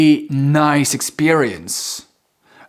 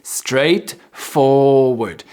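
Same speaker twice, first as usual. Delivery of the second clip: very slow, stretched STRAIGHTFORWARD; slow careful pronunciation; much slower than usual. Shown as a demonstration. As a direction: model a hard word syllable by syllable. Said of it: "It's hard to say".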